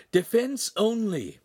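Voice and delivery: high, drawn-out warning cry; coach's urgent high-pitched cry. A narrator's reading. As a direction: call out a slow, high command to the fighter. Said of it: "Pan cried".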